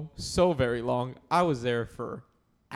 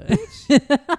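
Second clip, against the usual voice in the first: loud, high playful outrage; mock outraged shout; 13 semitones higher, 11 dB louder than usual. Real, not acted. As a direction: yell back at the insult. Reacts to conehead insult.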